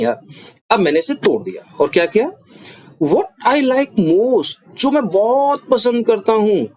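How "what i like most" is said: "What I like most" drawn out very slowly, higher; slow emphatic cleft. Shown as a demonstration. Teacher slowly demonstrates emphasis for students. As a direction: stretch each word to build focus. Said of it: "अब मैं किस चीज पर जोर दे रहा हूँ".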